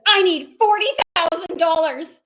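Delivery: high, loud retold outburst; exasperated demand. Imitating friend who needs money for a house, exasperated. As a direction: protest at a high pitch, overwhelmed by the task.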